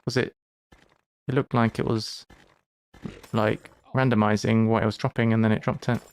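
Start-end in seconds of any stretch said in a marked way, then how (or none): none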